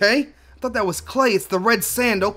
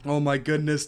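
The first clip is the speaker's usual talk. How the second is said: ordinary delivery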